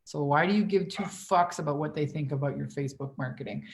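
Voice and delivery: ordinary delivery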